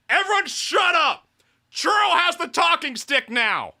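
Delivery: put-on high, loud pirate-girl yell; bossy shout. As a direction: bark for silence from the whole room. Voicing Churro claiming the stick.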